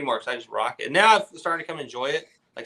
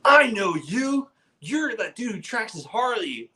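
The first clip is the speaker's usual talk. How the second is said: high, loud and slow, an excited stranger recognizing him; 8 semitones higher, 3 dB louder, slower than usual. Retold or imitated. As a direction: stretch it out high like a delighted fan. He goes.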